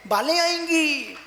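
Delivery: high, impassioned sermon voice; fiery preaching. Acted. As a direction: preach it at full force to the crowd. Preaching at full force. (जोशीला प्रवचन)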